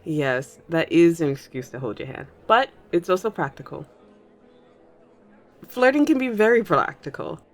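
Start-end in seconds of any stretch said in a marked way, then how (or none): 5.7-7.4 teasing. Acted. Teasing listener while taking hand.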